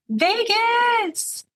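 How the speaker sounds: put-on high drawn-out drunken whoop; yelled. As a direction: holler it high without real volume. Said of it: "and yelled".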